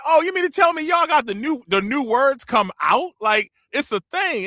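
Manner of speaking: high, surprised voice, slowing at the end; high-pitched amazement at the new slang; amazed. Retold or imitated. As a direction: act stunned by the news. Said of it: "I'm like".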